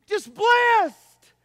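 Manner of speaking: high, loud, falling cry; exultant. Real, not acted. Preacher exulting before the church, loud. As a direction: exult again.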